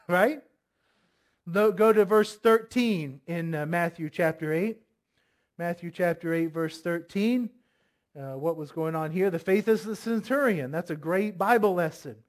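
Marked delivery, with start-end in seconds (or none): none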